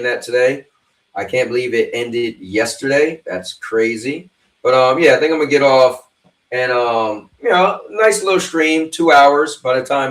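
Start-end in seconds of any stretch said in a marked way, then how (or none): none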